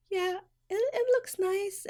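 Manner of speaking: high, soft, fake-sweet reply; high, soft put-on niceness; fake-polite. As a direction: force a high, sweet lie through the hurt. Fake politeness to bad stylist.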